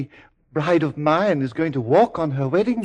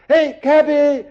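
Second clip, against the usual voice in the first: loud, high hail across the street; calling out; 7 semitones higher, 8 dB louder, slower than usual. Acted. Man hails a passing cabby, shouted call. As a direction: shout to flag down the cab.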